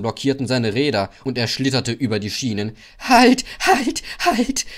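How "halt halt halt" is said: high, loud frantic stop cry; will the wheels to stop; panicking engine; desperate halt. A narrator's reading. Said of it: "dachte er".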